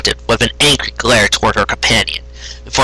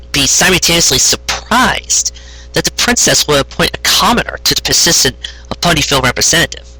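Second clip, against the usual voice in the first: usual speaking voice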